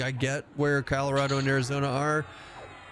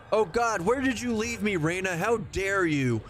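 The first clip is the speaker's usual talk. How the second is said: mock outrage; 8 semitones higher than usual. Mock outrage at Reina's tabs.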